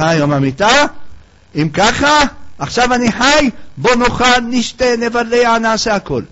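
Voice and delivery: rally friends to eat and drink; gleeful reveler. Acted. A reveler calling his friend to eat and drink, high and loud with glee. Hedonist celebrates death-day.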